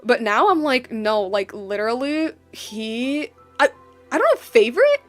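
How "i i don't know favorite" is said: ordinary talk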